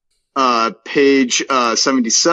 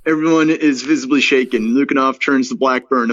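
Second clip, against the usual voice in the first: usual speaking voice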